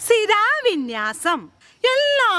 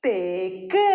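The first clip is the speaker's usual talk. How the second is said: put-on children's answer again; 9 semitones lower, slower than usual. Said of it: "ഒന്നും കൂടി പറഞ്ഞേ".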